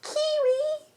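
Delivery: very high sing-song call; high cooing call to a pet; calling the cat. Real, not acted.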